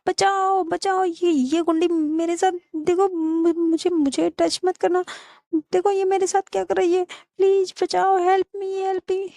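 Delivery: hammed-up damsel in distress; crying for help. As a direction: plead frantically for rescue. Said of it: "Sarika ऐसे चिल्लाने लगती है".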